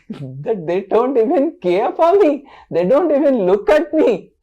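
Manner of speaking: loud, high-pitched wounded protest; hurt complaint. Acted. Ignored Krishna complains about the gopis, hurt. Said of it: "Krishna felt so bad".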